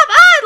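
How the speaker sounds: high loud panicked shriek; mock scream. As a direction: imitate a passenger about to crash. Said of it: "Makoto was like".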